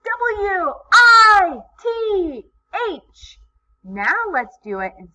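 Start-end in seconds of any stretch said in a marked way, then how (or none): 0.0-3.4 very high, loud cheer, drawn out; super happy voice. Shown as a demonstration. Teacher cheering the spelling with young learners, super happy. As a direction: shout each letter out slowly with over-the-top joy. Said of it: "do it in a super happy voice".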